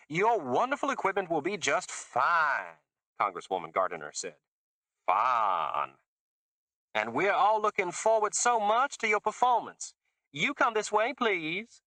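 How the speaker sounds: put-on high, gushing Southern accent; a fake Southern accent, high and gushing; fake Mississippi accent. A narrator's reading. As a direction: lay the fake Mississippi on thick. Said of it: "It was fake Mississippi".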